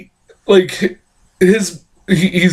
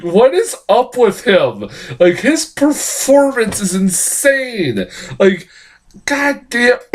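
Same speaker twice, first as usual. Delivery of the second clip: higher, slower, disbelieving; baffled awe at the screen; stunned reaction; 7 semitones higher, slower than usual. Retold or imitated. Reviewer recalling watching Cage's bloody final shot, high astonished voice. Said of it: "I'm like".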